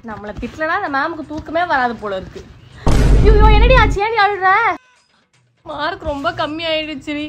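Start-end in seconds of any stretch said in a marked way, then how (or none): 3.2-4.5 loud, high alarmed cry; alarmed concern. Acted. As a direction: rush to a crying friend in alarm. Alarmed at crying friend. (அழும் தோழியைக் கண்டு பதறும் உரத்த குரல்)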